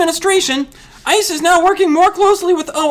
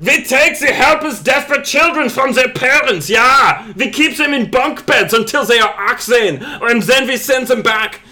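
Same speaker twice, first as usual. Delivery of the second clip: shouted mock German accent; hammed-up German accent, ranting; 3 semitones lower than usual. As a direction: bark it in a caricature German accent. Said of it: "Maybe I should use a German accent".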